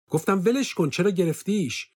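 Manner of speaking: high, loud exasperated demand; angry order. A narrator's reading. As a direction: snap it out, high and sharp. Angry order in story quarrel.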